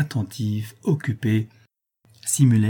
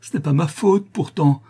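high, loud, frantic outburst; anguished crying out; 8 semitones higher, 6 dB louder than usual. A narrator's reading. Daughter blames herself for father's madness, anguished cry. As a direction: wail with guilt and horror. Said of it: "s'écria-t-elle".

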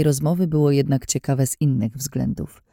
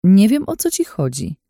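confident voice; 6 semitones higher, 4 dB louder than usual. A narrator's reading. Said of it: "powiedziałam pewnym głosem".

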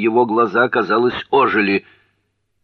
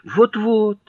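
high-pitched, louder agreement; eager confirmation; 5 dB louder than usual. A narrator's reading. Jherek confirms the tea's name, high and eager. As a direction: agree eagerly, voice jumping right up. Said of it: "подтвердил Джерик".

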